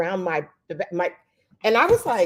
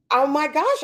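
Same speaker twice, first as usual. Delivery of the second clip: higher, surprised gasp of words; retold surprise; 8 semitones higher, 3 dB louder than usual. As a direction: react like it caught you off guard. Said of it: "And I was like".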